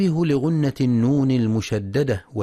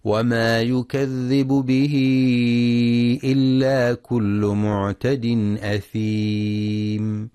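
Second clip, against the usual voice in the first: Quran recitation; slower than usual. Shown as a demonstration. Teacher recites the verse aloud for listeners, slow chant. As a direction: draw out the verse in a measured, ringing chant. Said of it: "وفي قوله سبحانه".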